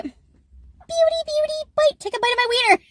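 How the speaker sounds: very high, squeaky mock voice; teasing, high-pitched silliness; silly playful voice. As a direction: squeal it in a goofy falsetto. Playful silly voice before biting.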